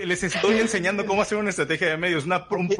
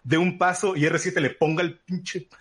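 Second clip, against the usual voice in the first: ordinary talk, nothing marked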